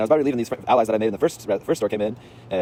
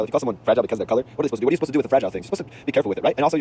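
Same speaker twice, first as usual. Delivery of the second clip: normal talking voice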